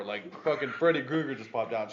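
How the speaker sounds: ordinary delivery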